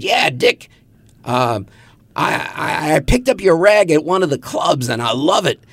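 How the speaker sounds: high-pitched radio DJ impression; Murray the K. DJ praising a young publisher's paper, high radio-host voice. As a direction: hype it like an on-air personality. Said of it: "And he said".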